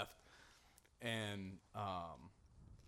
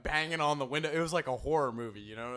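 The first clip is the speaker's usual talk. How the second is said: usual speaking voice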